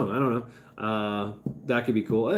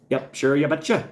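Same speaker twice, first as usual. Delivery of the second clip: a light hint of a folksy Upper Midwest accent, lightly higher; mock Minnesota accent; 4 semitones higher, 3 dB louder than usual. Joking with chat, a lightly put-on Minnesota accent. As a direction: ease into a sing-song Minnesota "you betcha".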